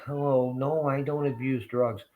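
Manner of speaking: high-pitched mock-polite voice; mock politeness. Dressed-up drug abuser talking to doctors, mock polite. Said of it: "go politely".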